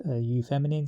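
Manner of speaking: usual speaking voice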